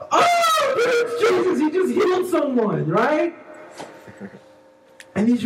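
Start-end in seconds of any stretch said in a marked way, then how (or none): 0.1-2.9 very high, loud mock squeal of amazement; amazed witness. Preacher plays an onlooker seeing Jesus heal, amazed. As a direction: gasp it out like an awestruck onlooker, hammed up.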